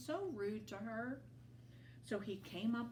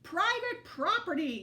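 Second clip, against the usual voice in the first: loud, high grumpy yell; cranky cactus yelling much louder; 10 semitones higher, 8 dB louder than usual. A narrator's reading. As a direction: bark it at the intruder. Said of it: "yelled Hank".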